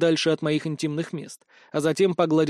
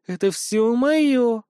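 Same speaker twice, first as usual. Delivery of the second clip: slow, higher possessive purr; 6 semitones higher, much slower than usual. A narrator's reading. Shango kisses the hero's neck, purring. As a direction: purr it slowly, stretching the words. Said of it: "промурлыкала Шанго".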